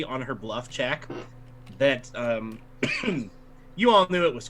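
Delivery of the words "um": ordinary delivery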